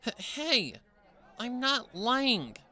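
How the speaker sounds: high, slow, stammering protest; pony being grabbed by the leg, protesting she's honest; flustered protest. A narrator's reading. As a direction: struggle free while insisting it's true. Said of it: "trying to get the security guard to let go".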